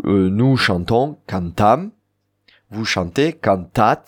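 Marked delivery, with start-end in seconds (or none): 1.3-1.9 "cantam" with a strong final "tam"; stress on last syllable. Shown as a demonstration. Teacher conjugating "nous chantons", stress on "tam" in "cantam". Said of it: "L'accent est sur la dernière syllabe". 3.3-4.0 "cantat" rising onto "tat"; stress on last syllable. Shown as a demonstration. Teacher conjugating "vous chantez", stress on "tat" in "cantat". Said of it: "L'accent est sur la dernière syllabe".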